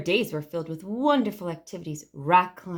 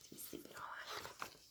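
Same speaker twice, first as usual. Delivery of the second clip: whispered; 27 dB quieter, much faster than usual. A narrator's reading. Narrator whispers bedtime.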